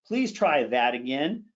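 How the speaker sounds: "that" picked out with emphasis; stress on that. Shown as a demonstration. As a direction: lean on "that", not the verb. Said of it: "Emphasis and intensity are absolutely critical".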